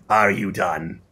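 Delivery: usual speaking voice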